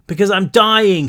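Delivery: yelled. A narrator's reading. Brick yelling to Rilke while clawing at the air. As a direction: cry out that you're dying. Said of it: "he yelled".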